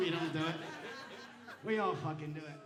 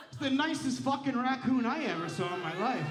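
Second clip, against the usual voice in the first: ordinary talk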